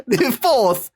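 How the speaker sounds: high, badly faked English accent; hammed-up Cockney-style English; chimney-sweep English accent. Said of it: "Sound like a chimney sweep".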